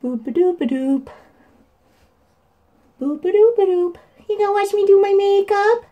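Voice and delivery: high sing-song cooing. Real, not acted. Auntie playing with baby while blending, high cooing. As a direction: bounce it up high like a silly game.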